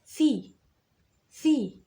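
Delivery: loud, high "ثي" as thi; teacher modelling "ثي" with kasra. Shown as a demonstration. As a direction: give "ثي" a clear thi. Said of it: "طب والكسرة؟".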